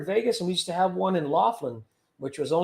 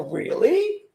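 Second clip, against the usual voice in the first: high, rising fake astonishment; mock disbelief; 12 semitones higher than usual. Scammer-baiter answering an angry caller, high mock surprise. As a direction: shoot way up in pitch, playing incredulous. Mocking reply to scammer's accusation.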